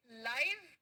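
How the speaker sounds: लाइव with an "eye" glide; diphthong live. Shown as a demonstration. Teacher asking children to repeat along, लाइव with "eye" vowel. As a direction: model लाइव to rhyme with "five". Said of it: "मेरे साथ में फिर से बोले".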